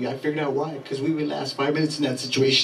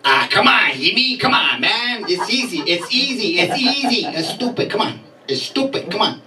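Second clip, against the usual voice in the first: put-on higher, pushy Mexican accent brushing it off; exasperated Mexican accent; 7 semitones higher than usual. Brother-in-law Carlos dismisses the question in a Mexican accent. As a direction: insist in the accent that it's easy. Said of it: "He goes".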